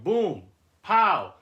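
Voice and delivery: playful fight sounds; mock fight noises. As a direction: punch out the comic sound effects.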